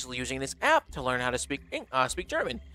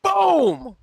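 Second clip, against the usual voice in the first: sudden high shout, full punch; excited shout; 12 semitones higher, 7 dB louder than usual. Real, not acted. Streamer to chat about translating, a high loud shout. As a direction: blurt it out like a big reveal.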